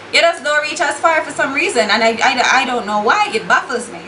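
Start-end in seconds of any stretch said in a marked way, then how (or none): none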